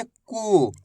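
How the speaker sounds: कू with a drawn-out long ऊ; खींचकर कू बोलना; long oo sound. Shown as a demonstration. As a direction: hold the ऊ in कू. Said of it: "खींच खींच के इसका उच्चारण करना है".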